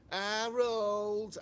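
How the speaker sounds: put-on calling out Harold as old Steptoe; old rag-and-bone man calling his son; Steptoe impression. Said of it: "And then he used to say".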